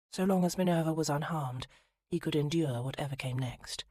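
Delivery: ordinary delivery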